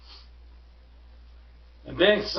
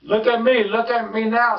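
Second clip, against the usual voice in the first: higher, louder boastful showman; showing-off cat; 8 semitones higher, 4 dB louder than usual. Acted. Cat balancing on the ball, boasting to the kids. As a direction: crow it out like a circus act. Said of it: "said the cat".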